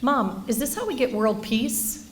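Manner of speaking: put-on a parent quoting her child's earnest question; ten-year-old son. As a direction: lightly imitate a curious young boy. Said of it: "my ten-year-old son said to me".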